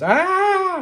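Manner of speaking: high, loud sustained scream. Acted. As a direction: scream out loud as the terrified turtle. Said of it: "turtle starts screaming".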